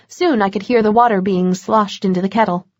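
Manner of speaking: usual speaking voice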